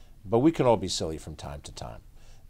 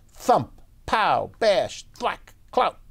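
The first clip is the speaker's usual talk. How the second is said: higher, loud cartoon sound effects; punchy fight sounds; 11 semitones higher, 5 dB louder, much slower than usual. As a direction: bang out each hit like a comic strip. Comic-book fight noises read out.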